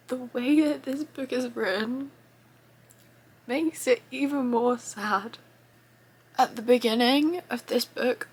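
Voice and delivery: softly tearful, barely keeping it together; holding back tears. Real, not acted. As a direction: speak through real tears you're trying to hold back. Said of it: "I've been trying to compose myself".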